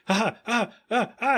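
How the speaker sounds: high, hammed-up ha-ha laugh; gloating fake laugh, pitched high; mock villain laugh. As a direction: laugh in his face. Peter laughing at Lois.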